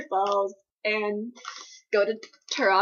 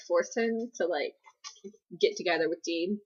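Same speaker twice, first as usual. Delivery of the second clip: usual speaking voice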